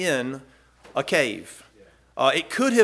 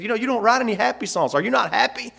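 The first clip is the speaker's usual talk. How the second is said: lightly voiced wife, higher, teasing; wife's teasing question; 7 semitones higher, faster than usual. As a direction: play the wife poking fun at sad songs. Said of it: "And she said".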